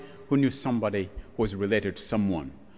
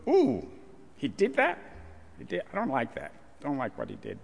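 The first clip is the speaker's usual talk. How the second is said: mock disgust; 5 dB quieter than usual. Congregation's disgusted reaction.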